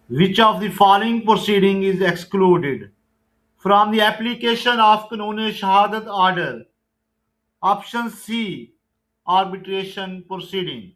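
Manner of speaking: loud, deliberate MCQ recitation; loud slow quiz reading. Acted. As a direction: call out the question and option slowly. Reads MCQ question and answer.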